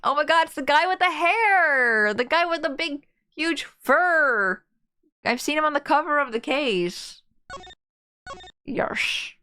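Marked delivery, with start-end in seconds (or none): 0.0-4.6 excited. Real, not acted. Excited fan reaction.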